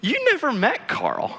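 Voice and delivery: very high, slow correction; imitated disbelief. Wife correcting husband in the car, imitated astonishment.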